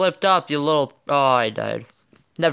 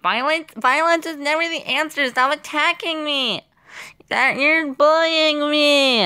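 high-pitched silly plea, stretched at the end; mock whining protest; 11 semitones higher than usual. Player protesting to attackers in the game, mock whining. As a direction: whine it way up high in a put-on voice.

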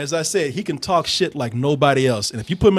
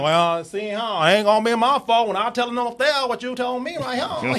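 lightly voiced slimy Cajun accent, a bit higher; heavy Cajun accent; 6 semitones higher than usual. Villain threatening rig workers in a light Cajun accent. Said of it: "real heavy Cajun".

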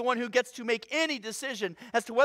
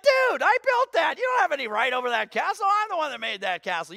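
high mock-outraged protest, easing down; indignant castle builder; 6 semitones higher, 4 dB louder than usual. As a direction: protest like a kid whose sandcastle was smashed. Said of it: "You're like".

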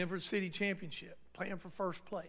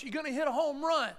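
louder and higher, full of conviction; ringing voice; 8 semitones higher, 8 dB louder than usual. Retold or imitated. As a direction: let it ring out with confidence. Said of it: "when I heard that voice".

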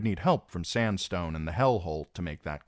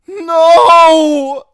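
long, very high scream; drawn-out piercing cry; shriek; 22 dB louder than usual. A narrator's reading. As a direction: shriek it in horror. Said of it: "she shrieked".

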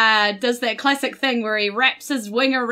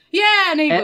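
higher, louder blurted yeah; emphatic confirmation; 6 semitones higher, 4 dB louder than usual. Acted. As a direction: burst out with the answer. Simon answers in character, acted.

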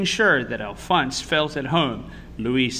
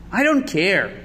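put-on higher, insistent child's protest; raised-voice declaration; defiant cry; 7 semitones higher, 6 dB louder than usual. A narrator's reading. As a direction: cry it out stubbornly. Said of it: "cried Louis".

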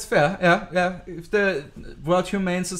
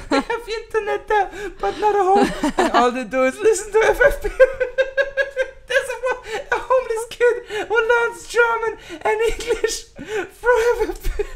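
laughing hard. Real, not acted. Joking about homeless FFP listeners.

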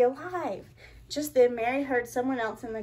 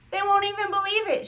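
excited; 8 semitones higher, 4 dB louder, faster than usual. Acted. Mary's joyful exclamation.

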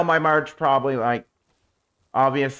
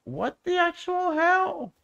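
baffled; 4 dB quieter than usual. Real, not acted. Baffled by a game glitch.

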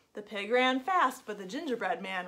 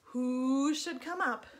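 usual speaking voice

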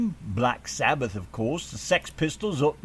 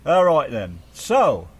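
louder announcer restart; radio host pep; 12 dB louder, slower than usual. Real, not acted. Host relaunching the show to listeners, loud and bright. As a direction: kick the segment off with punch. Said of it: "radio host restarting the show".

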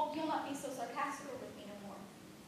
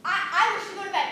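loud blurt; 5 semitones higher, 13 dB louder than usual. Acted. Servant backs down.

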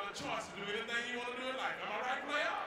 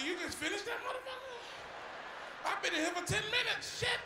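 high, loud disbelief at the wait; pitched-up loud complaint; exasperated outburst; 13 semitones higher, 5 dB louder than usual. Retold or imitated. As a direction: blow up at how long it took. Yelling at slow manager.

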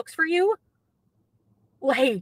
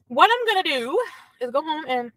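ordinary delivery

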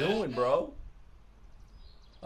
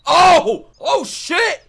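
excited yell; 11 semitones higher, 6 dB louder, much slower than usual. Real, not acted. Hype reaction to character entrance.

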